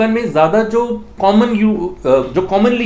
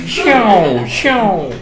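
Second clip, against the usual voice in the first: mock shriek; much slower than usual. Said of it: "वो बिल्कुल तांडव कर रही थी".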